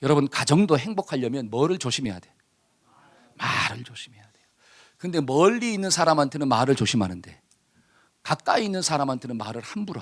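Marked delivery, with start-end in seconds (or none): 3.3-4.5 soft hushed whisper, almost no voice. Shown as a demonstration. Pastor whispering the answer to the congregation, hushed. Answers own question in hush.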